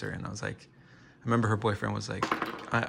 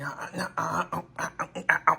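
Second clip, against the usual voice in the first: string of short, higher-pitched jerky yelps; mock twitching yelps; 7 semitones higher than usual. Streamer clowning as a twitcher on camera, mock twitching yelps. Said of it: "just Twitch on camera and be like".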